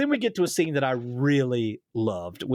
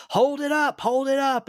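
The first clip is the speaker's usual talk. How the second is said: high, louder mock cheer; 11 semitones higher, 4 dB louder than usual. Family urging her to show the award, cheering. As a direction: call it out like proud family in a crowd.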